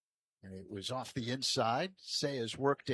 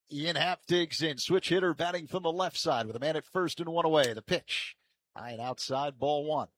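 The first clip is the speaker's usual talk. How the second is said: projected, brisk baseball call; live play-by-play; 4 semitones higher, 5 dB louder than usual. Real, not acted. Announcer setting up Happ's at-bat, live play-by-play. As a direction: announce the batter, then call the pitch.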